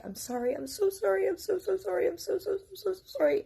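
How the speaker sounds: very high, pleading daughter voice; imitated frantic apology. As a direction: plead like a daughter begging forgiveness. Daughter's frantic apology, retold by mother.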